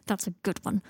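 ordinary delivery

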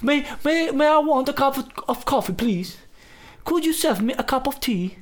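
high-pitched mock English politeness; exaggerated English accent; London accent. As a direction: order tea like a caricature Londoner. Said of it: "Hablan así".

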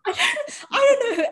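high, loud laugh spilling into words; giggling high through the words; laughing hard. Real, not acted. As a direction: crack up at your own fib. Laughing at own lie.

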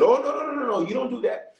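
high-pitched, hurried objection; protesting dealership staff. Acted. Dealership staff stopping a Rolls owner from towing the car, protesting. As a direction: jump up high in alarmed protest. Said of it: "They said".

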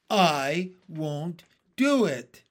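loud, slow refusal in a put-on voice; stubborn earthworm. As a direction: plant your feet and refuse.

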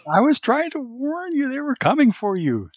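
high, loud, pleading protest; Kirsten. Acted. Kirsten explains herself to Cecile, high protesting voice. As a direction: insist in a high-pitched girlish voice. Acting Kirsten arguing on comm.

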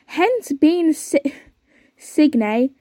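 usual speaking voice

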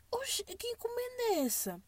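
high, whispered puzzlement; baffled whisper. Retold or imitated. Reading the delivery text, a confused whisper. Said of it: "Eu fiquei".

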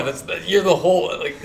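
laughing mid-sentence at the friend; teasing laugh breaking through. Real, not acted. Teasing his Southern friend, laughing.

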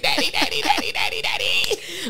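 high squealing daddy chant; pestering child. Retold or imitated. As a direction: whine like a begging kid. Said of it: "I was like".

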